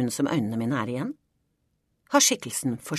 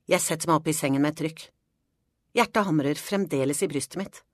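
ordinary delivery

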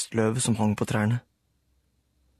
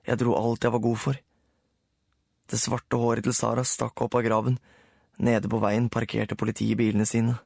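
ordinary delivery, nothing marked

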